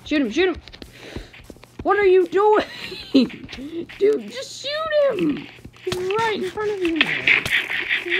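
high, loud disbelieving shout that cracks into a laugh; exasperated yell. Real, not acted. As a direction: yell at the useless skeleton. Exasperated at a missing skeleton.

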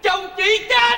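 very high, squealed disbelief; astonished. Acted. Visitor repeats that her husband died, astonished squeal. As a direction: squeal it in comic shock. Reacts to news of the death.

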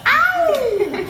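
high drawn-out "ow"; pained yelp. Acted. Buttons yelps in pain.

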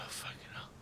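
startled breathless whisper. Real, not acted. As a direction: whisper in relieved fright.

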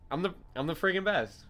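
usual speaking voice

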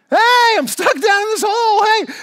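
drawn-out, very high, loud cry for help; frantic yelling. Acted. Man trapped as it gets dark, frantic yelling. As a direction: holler desperately, stretching the words. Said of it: "and he's getting frantic".

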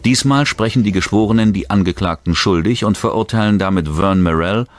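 punchy true-crime voice-over, driving each fact home; documentary narration. Acted. Narrator recounting the bank robbery trial, dramatic documentary read. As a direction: tell the heist like a tense TV documentary.

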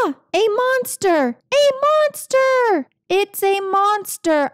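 high alarmed cries, pitch jumping up; cat yelping in fright at the vacuum; panicked shrieks. Acted. Cat spots the vacuum monster, shrieks in high panic.

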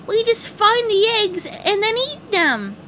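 high-pitched cartoon explanation; SpongeBob explaining. SpongeBob explains the egg hunt to Patrick, high-pitched.

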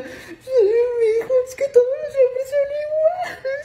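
hammed-up weepy lament; mock crying lady. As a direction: drag it out, sobbing like a jilted señora. Lady crying over her boyfriend.

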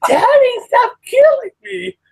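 high, drawn-out loud wail, imitating a small child; mock tantrum shriek, stretched out and far up high; pissed-off little kid. Imitating four-year-old nephew losing at Smash Brothers, pissed-off wail. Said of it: "He was so pissed off".

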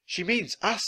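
high-pitched, ordinary volume; girl's realisation. A narrator's reading. Annie tells Jack the warning is theirs, high-voiced. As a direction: say it high as a sudden insight.

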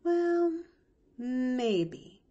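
high, drawn-out doubtful concession; skeptical, hesitant maybe; openly doubtful. Acted. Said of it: "granted Nancy with open doubt".

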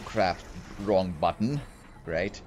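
normal talking voice

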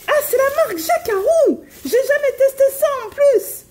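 high, thrilled voice; excited. Real, not acted. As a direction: discover it with delight. Excited discovering brand. (voix aiguë et ravie)

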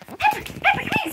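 very high sing-song calling; high-pitched dog call. Real, not acted.

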